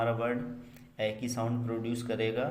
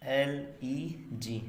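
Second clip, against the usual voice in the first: spelled out; 2 semitones higher, much slower than usual. Shown as a demonstration. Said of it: "spelling a word letter by letter".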